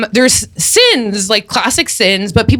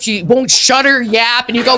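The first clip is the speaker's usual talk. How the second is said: normal talking voice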